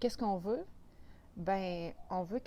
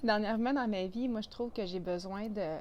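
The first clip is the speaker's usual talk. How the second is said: ordinary talk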